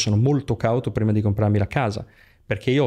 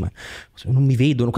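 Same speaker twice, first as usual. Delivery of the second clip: scared thought; slower than usual. Retold or imitated. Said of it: "sei veramente spaventato".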